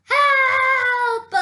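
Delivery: sudden loud cry of Help, pitched high; shout for help. Acted. Toad, dazzled by the sunlight, cries out loudly. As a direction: yell out blinded by the sun. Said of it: "said Toad".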